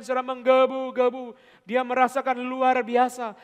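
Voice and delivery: loud, high, fervent preaching; preaching at full force. Acted. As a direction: build the sermon to a fiery peak. Preaching climax.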